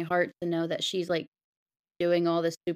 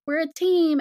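high, loud burst of enthusiasm; eager team cheer; 10 semitones higher, 6 dB louder than usual. Acted. Ms. Marvel to reluctant heroes, eagerly. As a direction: announce it brightly as the keen newcomer.

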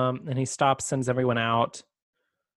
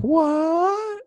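high, loud startled "What?"; shocked squeak; 3 dB louder than usual. Retold or imitated. Debrissa gasping out a stunned "What?" as Spike walks in. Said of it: "she's like".

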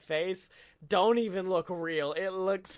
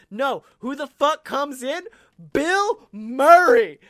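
big reveal shout; 6 semitones higher, 11 dB louder than usual. Acted. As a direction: yell the name like a stadium announcer. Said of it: "hyped reveal of Bill Murray".

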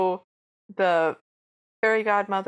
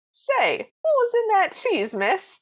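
much higher, slow New York wiseguy squeak; New York accent; 13 semitones higher, 5 dB louder, slower than usual. Retold or imitated. Mouse-men ask the fairy godmother, high-pitched New York accent. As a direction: stretch it out like a dazed Brooklyn cartoon guy. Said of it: "Brooklyn accents or New York accents".

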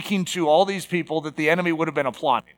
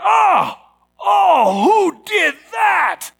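high, loud, drawn-out indignant shout; outraged outcry; 11 semitones higher, 6 dB louder, much slower than usual. Acted. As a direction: cry out in disgust at a nasty find. Reacts to manure on doorstep.

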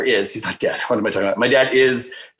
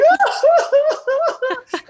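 squealing delighted laugh, very high; wife's tearful burst of laughter; high joyful laugh. Retold or imitated. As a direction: break into a shrill happy laugh. Wife's reaction retold.

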